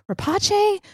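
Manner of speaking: Rapace said as "ra-PAH-chay", high; guessed pronunciation. Shown as a demonstration. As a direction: try "Rapace" with a "chay" ending, questioning. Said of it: "I still don't know how to say".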